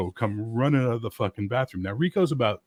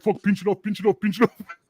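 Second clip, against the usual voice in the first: high, repeated frantic muttered urging; mock panic; 11 semitones higher, 4 dB louder than usual. Startled man in the bathroom urging himself, mock panic. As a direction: play up a comic hurried panic. Rico's hurried panic in the restroom.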